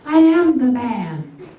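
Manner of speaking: high-pitched voice. Acted. Comic correcting a caller about the man of the house, high voice. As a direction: insist slowly in a voice gone high. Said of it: "my voice goes up high sometimes".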